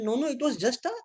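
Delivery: high, nervous backpedalling; scared pleading. Retold or imitated. Passenger backing away from the furious driver, pleading nervously. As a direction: plead quickly before he hits you.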